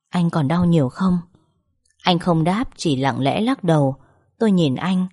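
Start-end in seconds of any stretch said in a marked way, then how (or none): none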